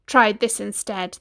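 plain reading voice

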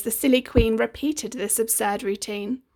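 ordinary delivery

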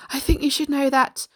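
captain short of breath; breathless. A narrator's reading. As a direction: blurt it out as if winded. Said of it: "the captain said breathlessly".

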